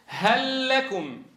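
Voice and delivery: هل لكم drawn out slowly, the two lams merged into one doubled l; slow recitation. Shown as a demonstration. Teacher leads the class in a slow, merged recitation. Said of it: "اقرأوها معي".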